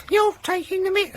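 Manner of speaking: very high-pitched mock Yorkshire complaint; squeaky, hopeless stab at Yorkshire; failed Yorkshire accent. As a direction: badly attempt an indignant Yorkshire caller, way up high. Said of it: "I can't do a Yorkshire accent".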